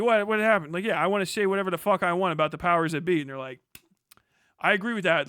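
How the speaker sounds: ordinary talk, nothing marked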